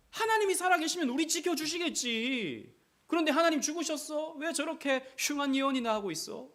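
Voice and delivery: high, loud jeering townsfolk; mocking crowd. Townspeople mocking Jeremiah, high and loud jeering. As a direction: sneer at the prophet. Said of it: "예레미야를 조롱하는 거예요".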